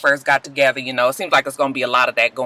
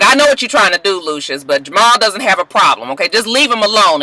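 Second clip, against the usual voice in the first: raised, sharp warning; mock Cookie scolding; 5 semitones higher, 4 dB louder than usual. Cookie telling Lucius to leave Jamal alone, sharp. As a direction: tell him off loud.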